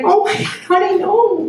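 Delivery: high, laughing dismay; horrified mom. Retold or imitated. Mother seeing her daughter's toilet paper, high laughing dismay. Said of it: "I was like".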